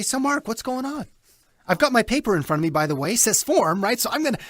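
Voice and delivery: high, relaxed, caring catch-up; genuine friendly. Shown as a demonstration. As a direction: talk like an old friend. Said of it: "I would just be real".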